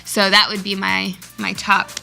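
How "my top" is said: normal talking voice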